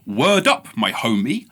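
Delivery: mock posh person doing street slang, jumping high then dropping; posh street talk. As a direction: imitate a posh joker trying street lingo. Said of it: "posh people talking in street language for jokes".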